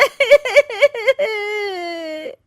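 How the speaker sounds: hammed-up fake wailing sob. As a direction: wail with put-on tears. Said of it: "रोने का नाटक".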